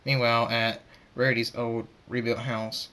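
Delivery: plain reading voice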